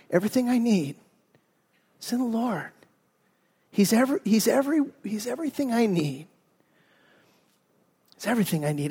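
soft and tender, as if it just dawned on her; quiet realization. Retold or imitated. Mother realizing she needs only the Lord, hushed and tender.

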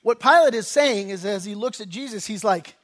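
ordinary talk

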